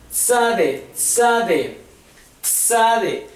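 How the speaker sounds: "tzade" begun with a crisp ts burst; tz sound. Shown as a demonstration. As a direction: say "tzade" with a sharp ts, not plain s. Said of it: "Se menciona la palabra tzade y es T Z".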